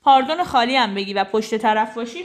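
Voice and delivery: normal talking voice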